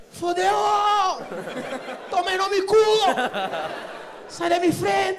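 loud, high hammed-up panic shouting; grito desesperado. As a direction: yell as if running late for a flight. Correndo, xingando em desespero. (berros desesperados e exagerados)

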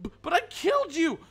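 very high, stammering whine; wailing disbelief. Real, not acted. Gamer who thought the enemy was dead, wailing disbelief. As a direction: wail it, aghast at the injustice. Wailing after losing in game.

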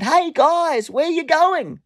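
high-pitched call to people walking away. Retold or imitated. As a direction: call after them across a distance. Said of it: "I called out to them".

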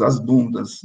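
usual speaking voice

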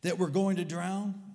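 high, loud question from alarmed disciples; shouted protest. Retold or imitated. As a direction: call it out in protest. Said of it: "they shouted at Jesus".

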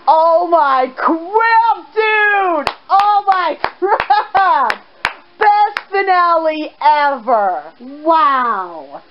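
slow, stretched-out amazement; awestruck. Real, not acted. Gamer telling a dude about the best finale, drawn-out awe. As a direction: draw the words out, blown away.

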